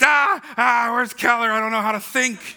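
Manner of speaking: high, loud frantic outburst; hammed-up desperate wail; mock panic. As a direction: cry out like a panicking addict. Acts out a panicking sermon junkie.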